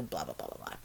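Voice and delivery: dismissive whisper trailing off; whispered blah blah. Retold or imitated. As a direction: breathe the blahs away, bored. Dismissive filler at quote's end.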